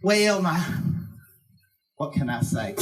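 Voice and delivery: usual speaking voice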